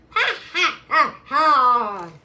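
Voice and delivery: very loud, high HA-HA, falling at the end; big hammed-up evil laugh; acted villain laugh. Evil toilet laughs in story.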